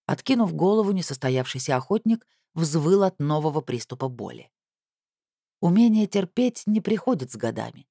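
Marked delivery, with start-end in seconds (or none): none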